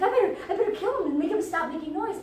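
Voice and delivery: high, loud, rushed babble; frantic retelling. Retold or imitated. Montresor deciding to silence his prisoner, frantic. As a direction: gabble it in mock panic. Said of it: "He's like".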